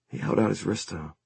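usual speaking voice

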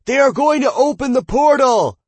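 loud excited shout. A narrator's reading. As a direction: call it out across the crowd. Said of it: "a shout rang out".

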